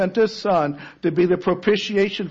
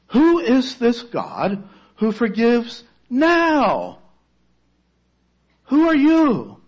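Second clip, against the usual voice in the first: higher, louder, slow pleading question; urgent question; 7 semitones higher, 3 dB louder, slower than usual. Retold or imitated. Afshin asks the voice who it is, higher and urgent. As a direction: ask in bewildered urgency. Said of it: "he asked".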